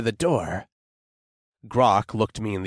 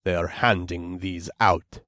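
gruff orc voice; 5 semitones lower, 3 dB quieter than usual. A narrator's reading.

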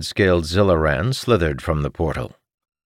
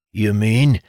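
higher, louder astonished character voice; wide-eyed disbelief; 8 semitones higher, 4 dB louder than usual. A narrator's reading. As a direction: ask it amazed, pitch lifted. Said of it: "Jacob's eyes went wide".

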